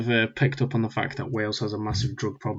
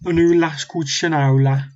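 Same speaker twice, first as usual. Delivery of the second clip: loud, higher mock Birmingham accent; 5 semitones higher, 5 dB louder than usual. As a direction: send up a Birmingham accent loudly. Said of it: "start talking with a Birmingham accent".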